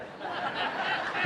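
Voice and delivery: a quick airless laugh at his own joke; short breathy laugh. Real, not acted. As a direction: let a soundless chuckle slip out.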